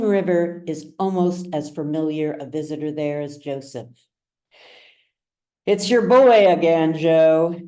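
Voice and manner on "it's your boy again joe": loud bartender calling across the bar. Acted. Bartender to Joseph as his boy arrives, loud call across the bar. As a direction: call it out over the tavern noise.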